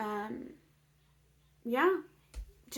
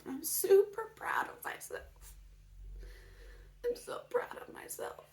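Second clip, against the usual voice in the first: tearful proud whisper, rising; emotional whisper; 8 semitones higher, 6 dB quieter than usual. Real, not acted. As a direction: whisper it through happy tears. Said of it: "So sorry I got emotional".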